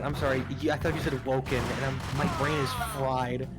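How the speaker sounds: ordinary talk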